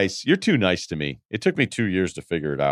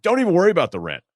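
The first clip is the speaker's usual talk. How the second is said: high, fast, excited reassurance; happy landlord; 10 semitones higher, 3 dB louder, faster than usual. Retold or imitated. Happy landlord letting the tenant off rent, excited. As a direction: wave it off, high and quick with delight. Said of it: "And he was so happy".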